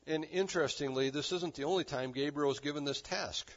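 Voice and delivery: usual speaking voice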